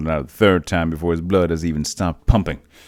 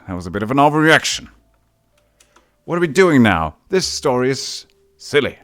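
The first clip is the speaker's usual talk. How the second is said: exasperated outburst; 6 semitones higher, 5 dB louder than usual. Real, not acted. Exasperated at absurd story.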